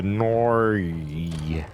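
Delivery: long drawn-out Aussie "nori" for no; exaggerated Australian accent. Shown as a demonstration. Streamer showing how to say no, exaggerated Australian accent. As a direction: stretch "no" into a broad Australian "nori". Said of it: "You gotta just go with a nori".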